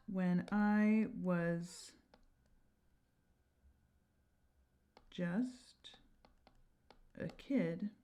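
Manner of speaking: slow, hushed, "just" barely breathed; whispered verse line. Shown as a demonstration. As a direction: drop to a whisper and draw it out. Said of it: "elide these vowels".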